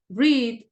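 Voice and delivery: read, vowel held and stressed; long ee. Shown as a demonstration. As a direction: read with a long ee, said loud and high. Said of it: "extiende la vocal".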